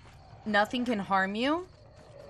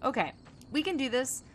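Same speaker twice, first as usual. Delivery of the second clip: usual speaking voice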